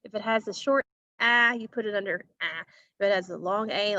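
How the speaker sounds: ordinary talk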